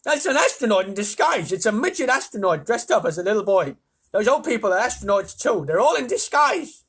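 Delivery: loud, high, insistent tirade; exasperated rant. A narrator's reading. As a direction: set the idiot straight. Ground control rants at astronaut.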